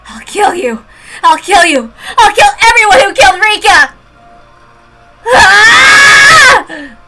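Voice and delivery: high furious shouts through tears; crying rage. Acted. Girl threatening the doctors, crying rage. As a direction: scream the threat, losing control. Girl stabbing her captors.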